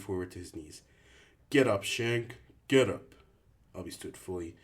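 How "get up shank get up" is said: loud, higher furious command; shouting at the new boy to stand; angry shout. Acted. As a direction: yank him up with a bark. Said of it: "Albie shouted".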